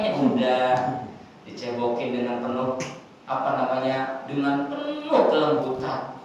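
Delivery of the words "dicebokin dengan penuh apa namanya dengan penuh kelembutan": tender, soft. Real, not acted. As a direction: lower and softer, gentle. Tender description of mother. (lembut dan pelan)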